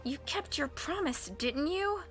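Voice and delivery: barely above a breath; murmur. A narrator's reading. Klaus pressing Madame Lulu secretly, murmured. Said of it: "murmured, scarcely above a whisper".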